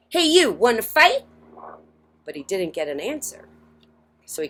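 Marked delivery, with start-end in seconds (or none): 0.1-1.2 high, loud picking a fight; grouchy ladybug challenge. A narrator's reading. Grouchy ladybug calling out to the whale, high and loud. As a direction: taunt the whale like a tiny bully. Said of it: "the grouchy ladybug".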